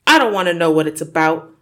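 loud, fast angry bark; barking father. Acted. Father cutting off his daughter with a loud bark. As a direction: cut her off with a furious bark. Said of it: "Mister Wormwood barked".

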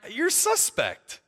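high, slow, doubtful verdict; mock suspicion. Listener answering a self-praising politician, mock suspicious and high. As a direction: draw it out with playful suspicion.

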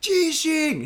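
high, loud shout; shouting earthquake at the confused driver; shouted warning. Retold or imitated. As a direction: yell it so he hears.

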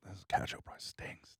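whispered aside. Real, not acted. Muttered aside.

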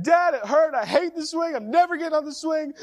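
high, loud tearful wail of an upset son; screaming, crying child. Retold or imitated. Son bawling to his dad after falling off the swing, screaming. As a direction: imitate a kid freaking out through tears. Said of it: "through his tears ... he's just screaming, freaking out".

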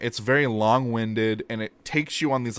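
normal talking voice

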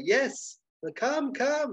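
high-pitched eager beckoning; eager waiter. Acted. Waiter leading dealer to the dining room, high eager urging. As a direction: usher him along excitedly.

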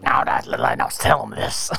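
very low, gruff wrestling-boss growl; low booming promoter voice; Vince McMahon voice. Retold or imitated. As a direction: bark orders into the headset like a wrestling boss. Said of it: "I did a Vince McMahon voice".

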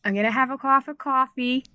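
put-on tentative Boston accent, broad vowels on coffee. British host trying Boston on request, shaky-confidence Boston accent. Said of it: "let's hear the Boston".

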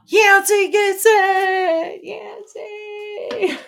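high, sung-out gasping announcement; excited cheer. Real, not acted. Host cheering a viewer's right answer, high and sung. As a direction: celebrate the winner out loud.

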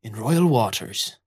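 lightly voiced rum-soaked pirate captain. Captain warning two squabbling pirates, lightly rum-soaked. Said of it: "with rum-heavy breath".

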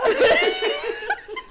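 squealing laugh; high shriek of laughter. Real, not acted. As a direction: lose it laughing. Laughing hard.

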